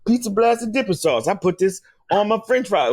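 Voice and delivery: put-on ad enthusiasm; mock product plug. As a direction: act out a corny sponsor read. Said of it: "but you gotta say".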